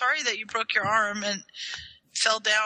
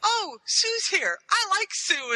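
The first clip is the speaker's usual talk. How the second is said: higher, slow goofy voice for a friend; imitating Mike; 9 semitones higher, slower than usual. Retelling a friend's lagging call, slow higher mock voice. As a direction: drag it out in a silly higher tone. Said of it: "'Cause he's like".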